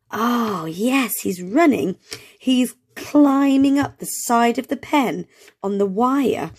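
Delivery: normal talking voice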